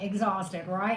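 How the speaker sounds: ordinary talk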